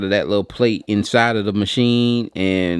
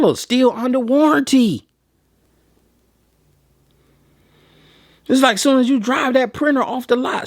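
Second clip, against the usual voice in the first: pitched way up, building into an exasperated rant; worked-up rant; 12 semitones higher than usual. Real, not acted. Vlogger venting to printer buyers about eBay prices, pitched-up exasperated rant. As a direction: hammer home how fast it loses value, voice climbing. Ranting about resale value drop.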